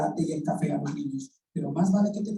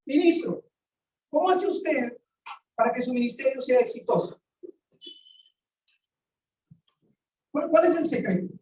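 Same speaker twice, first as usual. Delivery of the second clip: raised, pitched-up questioning reporter; eager reporter; 6 semitones higher than usual. Retold or imitated. Reporter asking the minister his secret, pitched up. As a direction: ask the famous preacher like a curious journalist.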